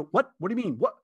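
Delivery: high, startled incredulity, played for effect; baffled. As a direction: imitate a stranger caught off guard. Acting out baffled people in line.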